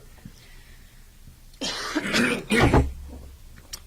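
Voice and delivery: long dry coughing fit. Real, not acted. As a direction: cough hard, throat tickled. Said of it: "need some water".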